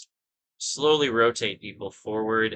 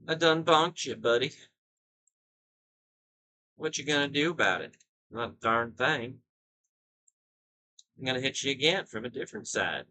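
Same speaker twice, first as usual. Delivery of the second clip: put-on higher, playful country-accent taunt; 4 semitones higher than usual. Player taunting a unit just bonked, mock country accent. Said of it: "I don't know why I adopted an accent there".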